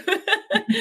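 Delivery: bright high-pitched laugh. Real, not acted. Laughs after thanks.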